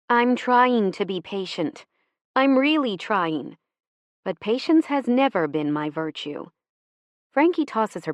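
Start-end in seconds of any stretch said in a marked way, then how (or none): none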